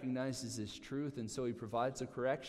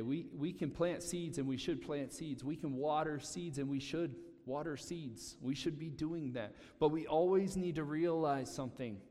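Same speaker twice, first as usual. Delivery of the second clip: ordinary delivery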